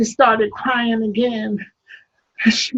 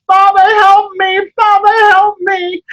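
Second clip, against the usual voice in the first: loud pleading call for help; desperate cry; 6 semitones higher, 8 dB louder than usual. Acted. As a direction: cry out to the father in pain. Sarah crying out under whip.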